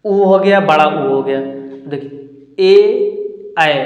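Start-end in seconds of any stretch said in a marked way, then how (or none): 1.9-3.7 ए and ऐ drawn out slowly and higher; teacher sounding ए, ऐ slowly; slow vowel demo. Shown as a demonstration. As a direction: stretch ए then ऐ for the class. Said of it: "ऐसा लग रहा है जैसे जीभ".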